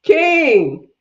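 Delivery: loud, high, falling call of a name; delighted shout. Real, not acted. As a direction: shout out to a friend who just popped on. Calling out to arriving friend King.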